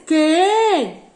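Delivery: "¿Qué?" called out loud and high; loud, high emphatic "¿Qué?" showing its accent; stressed question word. Shown as a demonstration. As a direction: punch "¿Qué?" like announcing it to the class. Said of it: "deben de llevar acento".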